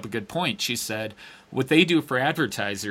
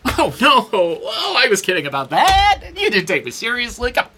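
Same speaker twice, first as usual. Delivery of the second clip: much higher, louder hammed-up whimper; mock backpedaling whine; 11 semitones higher, 6 dB louder than usual. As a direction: play her pleading and backpedaling.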